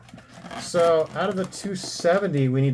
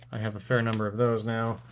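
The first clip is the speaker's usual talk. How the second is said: normal talking voice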